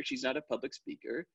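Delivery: ordinary talk, nothing marked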